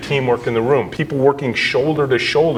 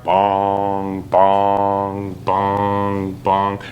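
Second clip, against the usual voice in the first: imitated bong; 3 semitones lower, much slower than usual. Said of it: "at ten o'clock".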